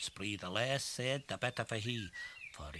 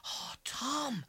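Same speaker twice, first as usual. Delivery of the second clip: put-on dismayed girl. Betsy dismayed.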